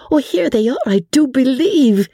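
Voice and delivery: put-on high, breathless excited girl. A narrator's reading. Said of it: "said Sally excitedly".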